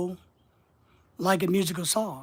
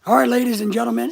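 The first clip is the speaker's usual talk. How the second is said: loud fast announcer opener; announcer call; 4 semitones higher, 6 dB louder, faster than usual. Shown as a demonstration. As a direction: open like a broadcaster. Announcer opening.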